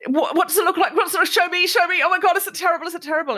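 put-on high, anxious, pleading teen voice fretting about his haircut; panicky teenage boy. Teen boy with half-cut hair begs his friend to show him, high and panicky. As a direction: squeak it out like a worried kid begging to see the mirror. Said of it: "And Yusuf kept going".

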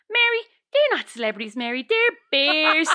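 high, deadpan mock voice; woman in the front row. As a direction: point out the obvious in a high voice. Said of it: "puts up her hand and goes".

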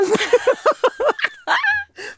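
a big shrieking laugh; loud high laugh. Real, not acted. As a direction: burst of high-pitched laughter. Laughing at the request.

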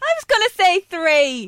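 high, loud and playful; excited. Real, not acted. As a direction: squeal it out teasingly.

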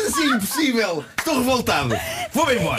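mock outrage. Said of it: "Ele está revoltado".

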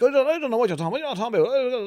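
high, flustered mock panic; mock-flustered protest, pitch jumping up; imitated panic. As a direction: imitate a dad babbling in a panic. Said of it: "when he's in a panic".

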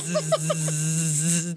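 long, low, droning buzz; imitated vibration buzz. Imitating vibrating toy in bag.

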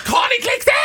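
high, loud desperate yell. Retold or imitated. Desperate smoker yelling in the shop entrance, high and loud. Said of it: "skrek jag i entrén".

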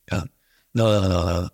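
normal talking voice

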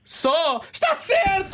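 high squealing mock surprise; mock amazement. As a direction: squeal it in fake awe. Mock amazement at athlete's list.

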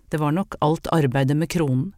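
ordinary delivery

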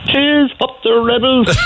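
laughing Cork greeting, pitched high; Cork woman, laughing. Retold or imitated. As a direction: cheer it like a proud Cork woman mid-laugh. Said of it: "So she said".

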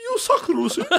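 put-on very high British-accented jeer through laughter; mock British heckle. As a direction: mimic a British fan mockingly, cracking up. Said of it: "drar på sånn britisk aksent".

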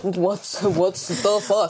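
slow, dazed mock American; confused rapper. Mimicking [name] lost in Malmö, slow dazed mock.

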